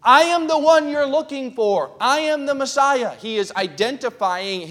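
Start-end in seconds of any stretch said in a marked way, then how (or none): 0.0-3.1 high, loud proclamation; raised voice announcing who he is; crying out. Acted. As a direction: cry it out like a prophet in the temple. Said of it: "he's crying out saying".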